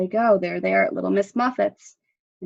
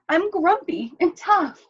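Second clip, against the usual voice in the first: pleading; 9 semitones higher, 3 dB louder than usual. A narrator's reading. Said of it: "pleaded Dog".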